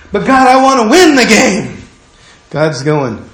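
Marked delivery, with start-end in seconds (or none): 0.1-1.8 a higher, louder eager plea; eager protest. Acted. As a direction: push to win, a bit higher and louder.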